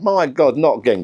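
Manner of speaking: high, exasperated wife's voice; voicing his wife's dismay. Wife's exasperated words retold.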